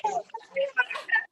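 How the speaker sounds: high, squeaky fake sob; hammed-up high-pitched weeping; mock crying. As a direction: whimper it in comic, theatrical tears. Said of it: "you just keep crying".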